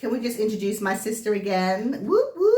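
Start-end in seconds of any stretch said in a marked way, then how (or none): 2.1-2.5 high, loud whoop whoop; hype call. Acted. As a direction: cheer her on. Hyping up her sister.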